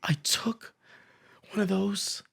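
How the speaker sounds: high, soft, slow, dazed voice; mock drained roommate pleading; imitating the sleepless roommate. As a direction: mimic a wired, exhausted zombie. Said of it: "But this is exactly how he talks".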